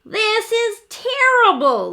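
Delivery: high, loud, slow dismay; little T upset that X is gone. A narrator's reading. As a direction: wail it like a tiny worried letter. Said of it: "little T said".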